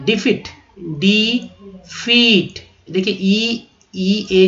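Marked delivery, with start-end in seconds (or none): 0.0-2.7 डिफीट drawn out very slowly, डि-फीट, falling end; slow model pronunciation. Shown as a demonstration. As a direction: stretch डिफीट out syllable by syllable. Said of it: "अब देखिए पराजय". (बहुत धीरे खींचकर: डिफीट)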